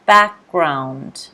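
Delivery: Background with "back" stressed and the G dropped; teacher modelling the stress in Background; stress on the first syllable. Shown as a demonstration. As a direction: lean on "back" in Background and let the G go. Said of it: "The stress is on the first syllable".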